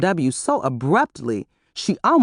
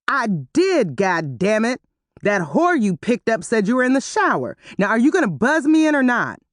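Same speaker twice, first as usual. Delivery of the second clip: angry; 6 semitones higher than usual. A narrator's reading. Terry angrily demanding entry.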